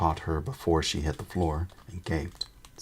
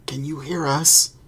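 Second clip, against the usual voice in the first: high, loud call; 10 semitones higher, 8 dB louder than usual. Acted. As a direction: shout it through the wall. Calling through the boards.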